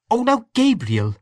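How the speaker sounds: high, amused protest; laughing. A narrator's reading.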